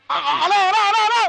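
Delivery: a worried man calling into the walkie-talkie; frantic calling. Acted. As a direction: call out desperately as the line drops. Line cut, calling into walkie-talkie.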